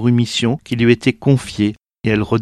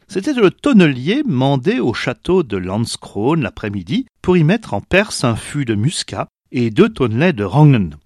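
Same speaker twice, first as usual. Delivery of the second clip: plain reading voice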